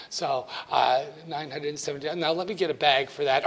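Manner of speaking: lightly voiced chatty old cashier lady; a hint of a fussy grandmotherly clerk; Mabel the cashier. Teacher as cashier Mabel fussing over student, lightly voiced. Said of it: "My name is Mabel".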